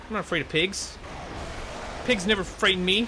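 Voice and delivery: mock bravado; hammed-up boast. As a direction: brag like a cartoon tough guy. Player boasting to pig.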